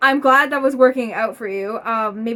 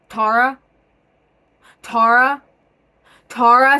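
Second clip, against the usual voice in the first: repeated calls, each louder and rising; calling her name; 8 dB louder than usual. Retold or imitated. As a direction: call out a name, louder every time. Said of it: "Says my name three times".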